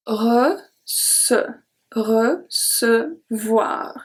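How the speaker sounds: recevoir syllable by syllable, very slowly; slow model word. Shown as a demonstration. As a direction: slowly spell out recevoir in sound. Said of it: "Next one is very interesting".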